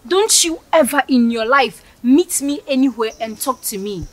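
ordinary delivery

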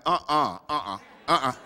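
disapproving uh-uh. Retold or imitated. Congregation disapproving at liquor store.

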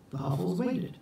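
ordinary delivery, nothing marked

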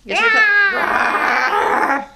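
hammed-up dino rah; mock T-Rex roar. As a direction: roar like a little dinosaur for the kids. Playing T-Rex roars.

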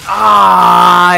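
long, very loud scream; drawn-out loud "ah" yell; frustrated scream. Real, not acted. Killed in game, cries out.

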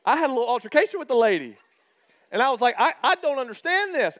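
laughing loudly through the words; cracking up while telling it. Real, not acted. Laughing to the church about the coffee fight.

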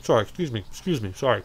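talking through a mouthful; chewing while apologizing; mouth full. Real, not acted. I'm eating a pumpkin roll.

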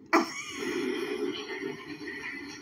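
breathless high-pitched nervous giggle; airy frightened titter. Real, not acted. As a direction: let a scared giggle slip out under your breath. Terrified laugh in horror game.

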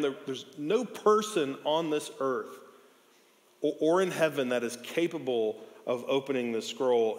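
ordinary talk

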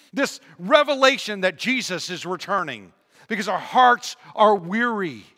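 loud, high, impassioned pulpit delivery; full-force preaching. Real, not acted. Preacher repeating the promise of his return, loud and emphatic. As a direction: drive it home to the congregation.

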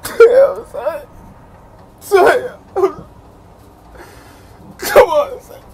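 high, crying whimpers; frightened near-crying on the ride; scared whimper. Real, not acted. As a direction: whimper in real fear.

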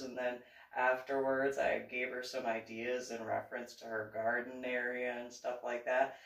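usual speaking voice

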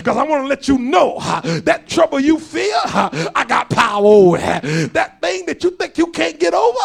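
driving cadence with a punctuating "huh"; full-force preaching. Acted. Preacher urges congregation to grow and trust God, full-force preaching. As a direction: build to a loud, exhorting sermon.

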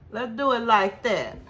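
ordinary delivery

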